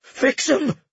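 high cracking plea; voice breaking. A narrator's reading. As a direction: let the voice break. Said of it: "his voice broke".

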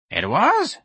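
high, rising question; interested query. A narrator's reading. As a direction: perk up with sudden interest. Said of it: "Miss Trixie said with interest".

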